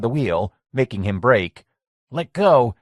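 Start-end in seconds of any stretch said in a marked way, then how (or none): 2.1-2.8 raised, higher, firmer demand; Mike's first firm demand to let go. A narrator's reading. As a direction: tell him to let go, firmly. Mike struggling with Lenny at wheel.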